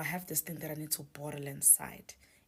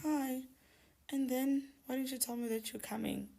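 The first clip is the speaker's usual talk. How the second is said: high-pitched, louder fake-casual greeting; pretending to be okay; 10 semitones higher, 4 dB louder than usual. Greeting the friend who turned up unannounced, pretending to be okay. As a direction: put on a high, breezy cover-up voice. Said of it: "I pretended to be okay".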